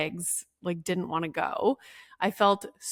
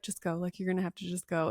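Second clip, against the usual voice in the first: much softer, imitated counselor; quiet urging; 8 dB quieter, faster than usual. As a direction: urge a stalling parent to leave, under your voice. Counselor quietly telling parent to leave.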